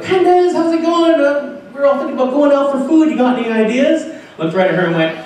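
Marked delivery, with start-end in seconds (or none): 0.0-4.1 bubbly friend calling out. Retold or imitated. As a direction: mimic the group greeting him brightly. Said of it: "they were like".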